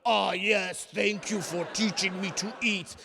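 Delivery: hammed-up grateful goblin voice; slow creature-like mock gratitude; goblin king. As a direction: thank her like a bridge-dwelling goblin. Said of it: "this fucking goblin king she found under the bridge".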